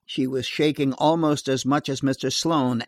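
plain reading voice

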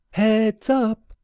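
high, giggly call from the sky; giggling call. Acted. Sewell floating overhead calls to her brother, high and giggly. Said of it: "she called down in a giggling voice".

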